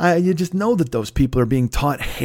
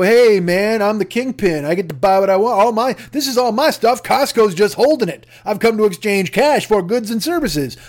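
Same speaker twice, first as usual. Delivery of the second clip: higher, louder, puffed-up swagger; mock swaggering slob; 6 semitones higher, 3 dB louder than usual. Slob bragging to Costco staff, mock swagger, higher and louder. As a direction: play the big shot who owns the store. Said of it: "swing your corpulence around and go".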